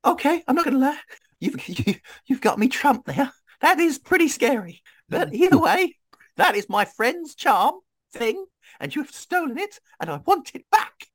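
very high, squeaky-register character voice; high-pitched game character. Acted. Adventurer confronting the one who stole a friend's charm, high character voice.

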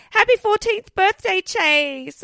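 high, loud celebratory read-out; mock birthday cheer. Co-host reads out the birthday cake message, mock celebratory. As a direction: call it out like a birthday greeting.

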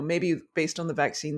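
normal talking voice

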